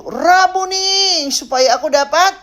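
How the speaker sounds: high, loud, stretched-out plea; pleading cry. Acted. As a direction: cry out to the teacher, long and high. Acts blind man's plea. (seruan memohon, lantang dan tinggi)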